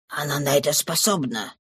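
whisper. Acted. Thénardier warns his men in a hushed voice. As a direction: breathe it to the others. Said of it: "шепнул Тенардье".

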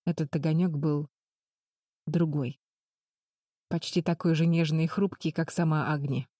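ordinary delivery

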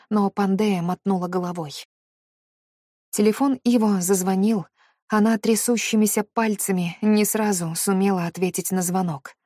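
ordinary delivery, nothing marked

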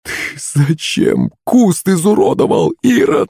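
high, drawn-out female wail; dismayed exclamation. A narrator's reading. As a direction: exclaim in shrill-pitched reproach. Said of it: "воскликнула Пышка". (возмущённое восклицание)

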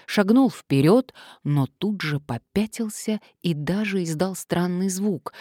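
ordinary delivery, nothing marked

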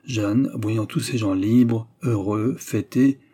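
ordinary delivery, nothing marked

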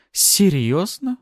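slow, high surprised question; genuinely surprised. A narrator's reading. Artara questioning her opponent, sincerely surprised. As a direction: draw it out in disbelief. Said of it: "Артара искренне удивилась".

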